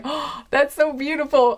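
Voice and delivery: high, breathy-free gush of delight. Retold or imitated. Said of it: "I was like".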